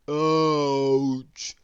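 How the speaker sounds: lightly voiced gruff yelp of pain; a hint of a hurt burglar; burglar's ouch. A narrator's reading. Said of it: "the burglar said".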